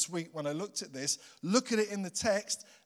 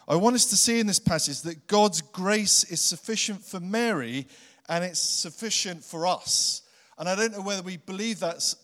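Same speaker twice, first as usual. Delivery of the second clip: louder full-voiced preaching; 5 dB louder than usual. Preacher opening Luke to his congregation, full-voiced and urging. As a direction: preach it out to the room.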